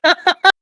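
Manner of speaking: short, high burst of chuckling; brief, high-pitched laugh in character; chuckle. Acted. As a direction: let out a quick amused chuckle. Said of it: "You actually get a chuckle out of him".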